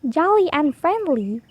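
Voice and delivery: plain reading voice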